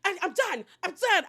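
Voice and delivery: high-pitched imitation of someone fuming; hammed-up fed-up fury; mock mad outburst. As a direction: act out an angry rant, rising in volume. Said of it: "She gets so mad".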